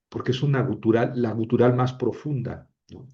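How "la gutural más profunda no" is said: ordinary talk, nothing marked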